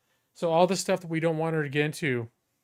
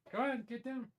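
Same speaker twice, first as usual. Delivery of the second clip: much higher, playful get-down to the puppy; high coaxing command; 10 semitones higher, 11 dB quieter than usual. Real, not acted. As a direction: shoo the dog off, half-teasing. Shooing puppy off furniture.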